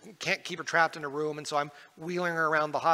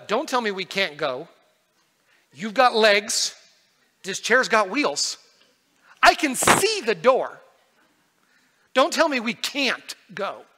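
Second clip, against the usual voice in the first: put-on loud, slow, insistent demand from a child; voicing his daughter; 5 semitones higher, 10 dB louder than usual. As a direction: spell out each point to Dad as if it were obvious. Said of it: "And she's like".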